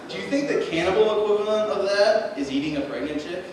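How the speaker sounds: usual speaking voice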